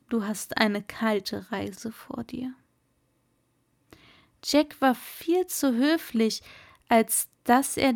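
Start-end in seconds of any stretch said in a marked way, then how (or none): 0.1-2.5 whispered warning, softer. Acted. Merman Kumara whispering to Jack about his cold journey. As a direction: lean in and whisper the last words.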